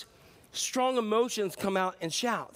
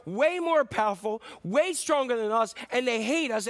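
usual speaking voice

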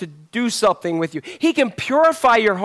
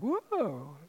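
mock surprise; 14 dB quieter than usual.

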